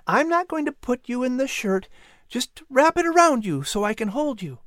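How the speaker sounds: put-on high-pitched, careful boy's voice; reassuring boy. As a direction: reassure a skittish bird in a high voice.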